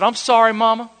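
high imitation of a little boy; imitated child's apology to his mother; nine-year-old boy. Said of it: "This little nine-year-old said".

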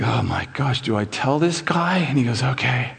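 hushed worry under the breath; nervous aside. Retold or imitated. Young man dreading the policeman, quietly anxious. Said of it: "he's like".

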